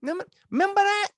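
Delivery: high, fast and loud; fast excited squeal of nostalgia; excited exclaim. Real, not acted. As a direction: burst out at the memory.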